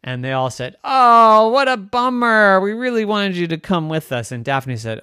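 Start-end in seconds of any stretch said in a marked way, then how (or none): 0.8-4.3 high, loud group groan, easing down; loud disappointment. Acted. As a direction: lament it like let-down friends. Friends voice disappointment.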